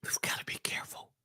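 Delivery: quick hushed whisper; a fast confiding whisper; whispered warning. Acted. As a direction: lean in and whisper the caution.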